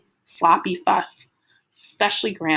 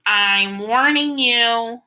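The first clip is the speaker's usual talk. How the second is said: slow, high, louder warning; a small kid puffing up to sound fierce; fierce and bold; 4 semitones higher, 5 dB louder, much slower than usual. A narrator's reading. Freak squares up to Killer Kane, fierce and bold. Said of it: "in that fierce, bold way that he has".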